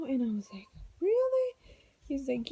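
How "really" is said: high-pitched retold surprise; surprised. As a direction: voice leaps up in disbelief. Retold surprise at dilation news.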